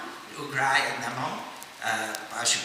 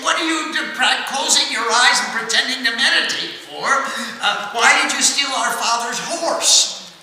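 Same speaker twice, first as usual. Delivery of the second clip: much higher, louder indignant shouting; angry accusing sons; 7 semitones higher, 7 dB louder than usual. Acted. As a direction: hurl the accusation at the sage.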